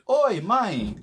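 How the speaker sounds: demonstrated attention-getting call. Pretend. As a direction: pretend to hail your mum across the street. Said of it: "get their attention".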